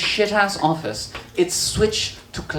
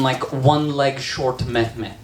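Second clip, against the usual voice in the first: ordinary delivery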